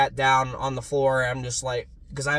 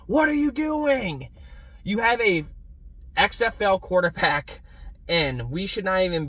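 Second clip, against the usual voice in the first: retold angry yell; 9 semitones higher than usual. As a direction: yell at the TV like the coach can hear. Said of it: "I'm sitting there screaming with my dad".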